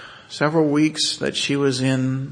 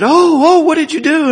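much higher, much louder surprised cry; astonished; 13 dB louder than usual. Retold or imitated. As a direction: gasp it out in disbelief. Doctor's astonishment at tongue response.

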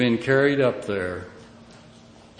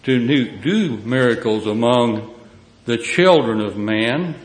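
fiery preaching; 6 dB louder than usual. Acted. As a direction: proclaim it from the pulpit. Preaching scripture at full force.

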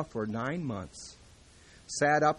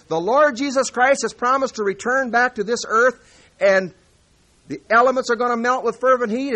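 fiery pulpit proclamation, louder and higher; full-force preaching; 6 semitones higher, 9 dB louder than usual. Acted. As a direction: thunder out the verses to the congregation.

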